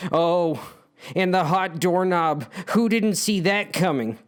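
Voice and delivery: ordinary delivery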